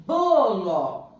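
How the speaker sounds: "Bolo" loud, with a long BOOO falling to lo; "Bolo" sounded out loudly, first syllable strongest; stress on BO. Shown as a demonstration. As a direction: lean hard on BO in "Bolo". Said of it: "A sílaba tônica é a".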